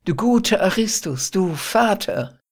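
delighted. A narrator's reading. Phyllis calling Aristus father, delighted. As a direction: gush with joy at the old man. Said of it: "rief das Mädchen ganz entzückt".

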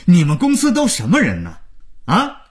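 high-pitched berating over the phone; indignant, insulting tirade; angry scolding. A narrator's reading. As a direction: tear into them, exasperated. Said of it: "听听万征骂到什么程度了".

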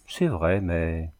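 plain reading voice

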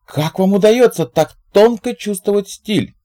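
very high admiring question; gushing praise. A narrator's reading. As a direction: praise him lavishly. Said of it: "похвалил Зиг Бига".

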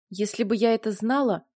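ordinary delivery

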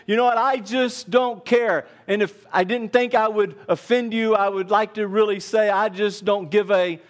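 high-pitched, driving preacher's voice; emphatic pulpit delivery; forceful preaching. Real, not acted.

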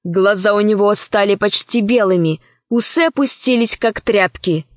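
plain reading voice

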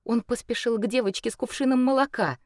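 ordinary delivery

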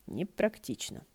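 ordinary delivery, nothing marked

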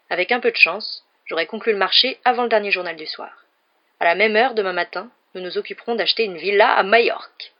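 loud, lifted, grand plans; self-satisfied, mounting enthusiasm; boastful anticipation. A narrator's reading. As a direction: crow about the coming deal. Uncle boasts of coming deal.

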